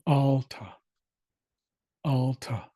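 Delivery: "alta" said slowly, stress on the first syllable AL, ending in a slight breath; "alta" with the A of at, then the A of father, stressed on AL; Chinook pronunciation demo. Shown as a demonstration. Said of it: "the accent goes on A L".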